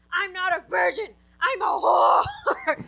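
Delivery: high, drawn-out mock yell; yelled confession. Daughter snapping at mother on the phone, yelled confession. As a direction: yell it out, stretching the last word. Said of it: "if you yell".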